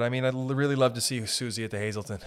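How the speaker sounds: ordinary delivery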